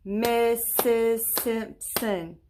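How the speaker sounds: "Missus Simpson" slowly in four separate beats; syllable by syllable. Shown as a demonstration. Teacher counting her name's syllables, "Missus Simpson" slow and separated. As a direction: chop "Missus Simpson" into four drawn-out, even parts. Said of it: "You wanna try it with me?".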